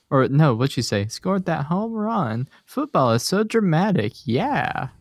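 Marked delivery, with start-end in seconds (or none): 1.1-4.9 imitating Missus Fram. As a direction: ham up the proud mom. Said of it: "she goes".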